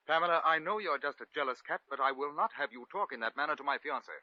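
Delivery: loud, high-pitched telling-off; indignant rebuke. Acted. Man defending his fiancée to a jealous rival, indignant rebuke.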